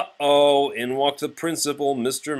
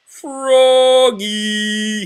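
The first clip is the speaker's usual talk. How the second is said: high, loud, proud shout of his own name; reading aloud loudly; 11 semitones higher, 9 dB louder than usual. Acted. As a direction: belt out the word he can read. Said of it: "louder and louder".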